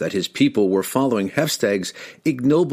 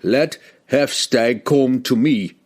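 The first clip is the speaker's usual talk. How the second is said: slow, raised, defiant challenge; 4 semitones higher, 3 dB louder, slower than usual. Acted. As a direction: throw down the challenge. Public challenge to the king.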